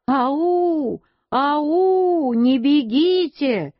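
long, high halloo without much force; calling out. A narrator's reading. Uncle calling after the fleeing mother, high drawn-out calls. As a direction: call after someone running off, stretching the vowels. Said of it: "а дядя всё кричал".

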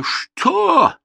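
very high, loud outraged tsar; furious tsar. Acted. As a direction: roar it like an offended king. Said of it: "закричал он".

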